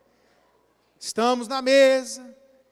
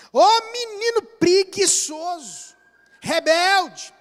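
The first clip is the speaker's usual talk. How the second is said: put-on higher, louder, slow scolding of a lazy boy; mock scolding; 5 semitones higher, 7 dB louder, slower than usual. Preacher imitating a parent scolding a lazy boy, higher and loud.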